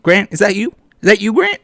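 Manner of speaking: teasing the suspected commenter, high mocking call. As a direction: call out to Grant as if he's hiding.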